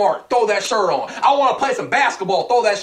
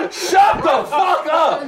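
shouted; 6 semitones higher, much slower than usual. Real, not acted. Yelling back at his friend, slow mock shout. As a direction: yell it out, drawn out.

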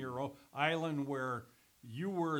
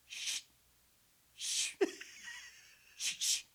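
breathy shushing sounds, spaced out; mock hushing; slower than usual. Older guy in the studio bathroom, imitated long shushing. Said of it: "it's like".

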